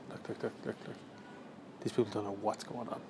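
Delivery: hushed aside about nearby strangers; whispering. Real, not acted. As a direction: keep it under your breath.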